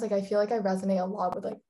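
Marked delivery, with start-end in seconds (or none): none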